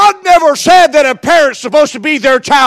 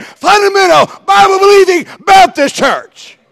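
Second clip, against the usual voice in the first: higher, louder, building to a shout; fiery preaching; 5 semitones higher, 3 dB louder than usual. Acted. Preacher rebuking worldly churches, building to a shout. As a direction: preach it hard, rising to the climax.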